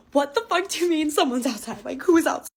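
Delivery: imitating her own terrified confusion; panicked disbelief. She asks her friends who is outside, frightened and loud. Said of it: "and I was like".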